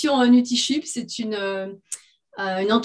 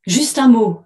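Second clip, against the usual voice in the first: usual speaking voice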